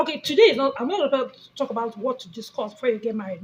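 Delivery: ordinary talk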